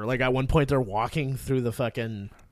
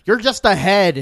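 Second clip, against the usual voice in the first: loud, raised-pitch comeback; exasperated retort; 7 semitones higher, 9 dB louder than usual. Retold or imitated. Ash answering his dead girlfriend's skull, loud exasperated retort. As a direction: throw it back at her, loud.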